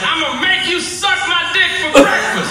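very high-pitched mock threat; hammed-up high convict voice; prisoner's threat. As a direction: play an unhinged convict up high. Acting prisoner threatening middle schoolers.

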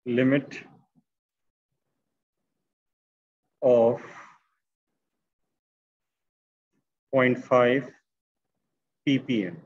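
low, isolated words with long pauses; slow dictation. Real, not acted. Teacher finishing the written conclusion for students, slow low dictation. Dictating word by word while writing.

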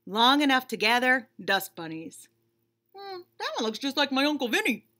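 ordinary delivery

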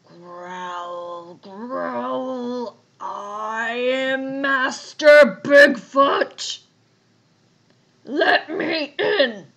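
slow growl swelling into a roar; growly bear. A narrator's reading. Bear demanding to be let in, growling loudly. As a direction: growl it big, loud and drawn out. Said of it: "a deep, growly voice".

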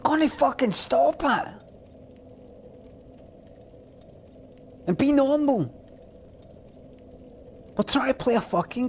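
high-pitched, exasperated yelling; exasperated outburst. Real, not acted. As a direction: blow up at someone who keeps distracting you. Streamer blows up at a distracting chatter.

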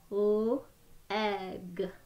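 each sound said separately with a short break; sounds tapped apart. Shown as a demonstration. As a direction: split the word into its sounds, one by one. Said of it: "Can you do that with me?".

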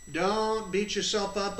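ordinary delivery

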